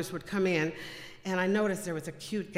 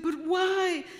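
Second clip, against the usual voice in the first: high, loud plaintive objection; emotional protest; 12 semitones higher, 5 dB louder than usual. Retold or imitated. Daughter questioning dad about giving it away, high upset protest. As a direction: plead against it, pitch leaping up. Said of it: "I was very emotional".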